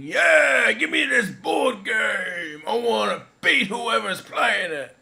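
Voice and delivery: hammed-up high, loud shouting; acted excitement. Voicing Alan's thoughts on the board game, exaggerated excited shout. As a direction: yell it with over-the-top eagerness. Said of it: "is what he was thinking".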